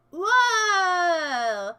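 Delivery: loud drawn-out whoa; real fright mid-list; startled cry. Real, not acted. Startled mid reading names.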